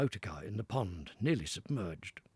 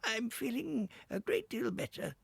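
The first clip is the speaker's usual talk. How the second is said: Toad as washerwoman; 13 semitones higher than usual. Acted.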